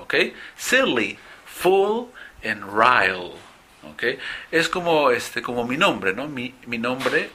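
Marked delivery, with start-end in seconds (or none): 0.6-3.3 silly, full and rile with a long L; long English L. Shown as a demonstration. Teacher repeating the words for practice. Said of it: "porque es un poco más largo".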